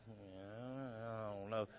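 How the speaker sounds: low, very soft, doubtful mumble; skeptical. Acted. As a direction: act out someone quietly unconvinced. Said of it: "You're even skeptical about it".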